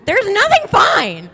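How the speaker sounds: loud, high-pitched mock panic; exasperated outburst. Panicked retort to reassuring text.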